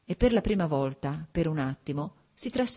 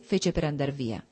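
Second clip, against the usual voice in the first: ordinary delivery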